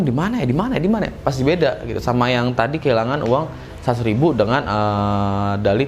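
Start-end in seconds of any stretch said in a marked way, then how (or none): 0.0-1.1 panicky searching. Retold or imitated. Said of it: "paniknya pun".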